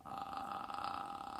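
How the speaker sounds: soft croak; croaking sound. Shown as a demonstration. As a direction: croak it out quietly. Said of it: "You do it too".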